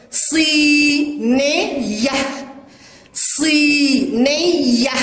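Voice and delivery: slow صينية, first ee stretched, split into syllables; long madd vowel. Shown as a demonstration. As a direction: hold صي in صينية, then short ni-ya. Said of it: "كلمة صينية بها مد بالياء". (تهجئة صينية مع مدّ الياء الأولى)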